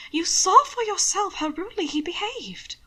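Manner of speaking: ordinary delivery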